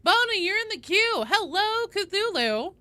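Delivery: high, loud call-out; loud greeting. Real, not acted. Streamer telling a player they are queued, loud greeting. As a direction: sing out the news high and loud.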